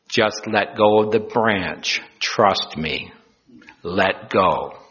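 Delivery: slow, steady divine instruction; measured, low-ending voice from above; voice of God. Acted. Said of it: "I am the Lord".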